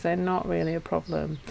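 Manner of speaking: ordinary talk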